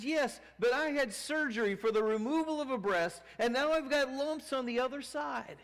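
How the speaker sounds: high, loud bitter patient voice; complaining woman. Retold or imitated. Cancer patient complaining to Miss Miller, high and loud. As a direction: push the grievance hard.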